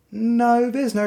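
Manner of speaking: high, loud, drawn-out no; emphatic no. Real, not acted. Film essayist rejecting the split between film kinds, emphatic.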